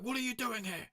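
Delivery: high squeaky guard voice; mock guard. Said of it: "they were like".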